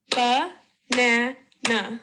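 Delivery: "Ba-na-na" broken into three drawn-out beats; sílaba por sílaba. Shown as a demonstration. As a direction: say "Ba-na-na" slowly, each syllable separate. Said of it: "broken up into syllable sounds".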